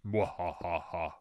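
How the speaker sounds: slow drawn-out evil cackle; mad scientist laugh. Pretend. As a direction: cackle like a cartoon villain. Villain laugh after dungeon threat.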